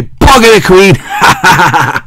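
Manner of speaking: slow, laughing cheeky shout; laughing mock shout. Host cheekily shouting insult at the Queen, laughing. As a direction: shout it while cracking up. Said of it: "I say".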